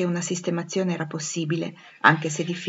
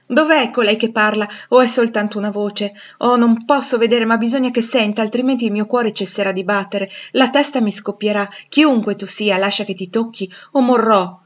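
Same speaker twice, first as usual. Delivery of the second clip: loud, impassioned pleading; desperate plea; 3 semitones higher, 6 dB louder than usual. Acted. Blind Rochester begging to touch the voice, desperate plea. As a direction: plead as if life depends on it.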